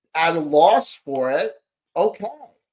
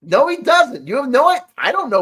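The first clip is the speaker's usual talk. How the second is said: heated rebuttal; 8 semitones higher, 6 dB louder than usual. Real, not acted. Rebutting the clip.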